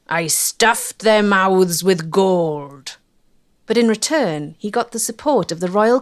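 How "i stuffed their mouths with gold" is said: slow, lightly voiced Nye, a touch louder; a hint of Nye savouring his line, slowly; quoting Nye. Nye boasting about the consultants' deal, lightly voiced and slow. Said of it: "Nye would say".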